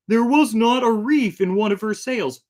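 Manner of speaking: loud, high pirate growl; hammed-up pirate voice; gruff pirate. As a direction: bellow it like an old sea dog. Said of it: "when this pirate says".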